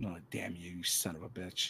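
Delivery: very soft, low mock curse; mock grumble. Host reacting to co-host's T-shirt idea, mock grumbling. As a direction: curse him under your breath in jest.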